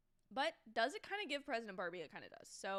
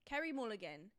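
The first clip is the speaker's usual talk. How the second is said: wobbly put-on British accent; mock British, carefully enunciated. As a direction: review it like an amateur British critic. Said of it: "I can't stick to a solid British accent".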